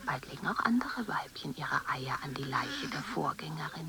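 hushed voiceless whisper, slowing at the end; creepy nature-film whisper; eerie whisper. Acted. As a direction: breathe it like a secret. Held whispered narration.

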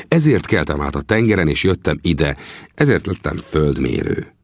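usual speaking voice

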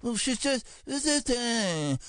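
mocking the grumblers, high and drawn out; a squeaky send-up of the cynics; mock naysayer. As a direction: sneer it out as a sour critic. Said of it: "and people will say".

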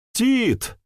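high desperate cry out; shouting a name. Acted. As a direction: yell the name in alarm. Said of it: "выкрикнул Ян".

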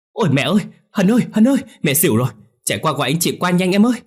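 very high, loud, fast screaming for help; panicked shout. Acted. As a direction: shriek for help as mother faints. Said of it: "hét lên".

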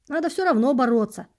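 emphatic, didactic exhortation; instructive. A narrator's reading. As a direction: urge it like a mentor giving a lesson. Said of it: "наставительно сказал спасший меня бродяга".

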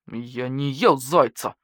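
slow, dogged wolf; stubborn denial. A narrator's reading. Wolf repeats his innocence to the investigators, slow and stubborn. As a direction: insist stubbornly, word by word. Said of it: "упрямо повторил волк".

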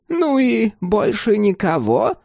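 high, slow crone question; old woman prying. Acted. Old woman probes landlord.

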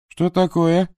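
sharp, high startled question; surprised question. Acted. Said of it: "переспросил Дот".

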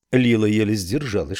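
usual speaking voice

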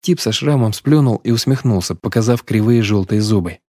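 plain reading voice

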